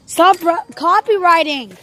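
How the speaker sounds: loud scolding; yelling stop. Real, not acted. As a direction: tell him off loudly. Scolding friend over music.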